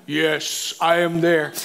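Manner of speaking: deep, low God voice; voice from heaven. Retold or imitated. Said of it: "he hears a voice from heaven".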